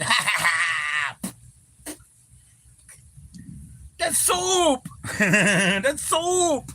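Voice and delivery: very high, laughing cackle of a line; laughing mock Cheech. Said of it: "he's like".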